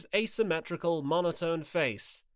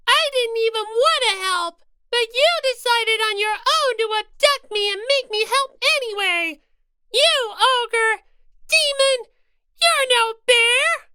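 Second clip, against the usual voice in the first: high-pitched indignant rabbit mascot; Monomi voice; 10 dB louder than usual. Acted. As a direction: protest higher, wounded and cross. Monomi protesting angrily.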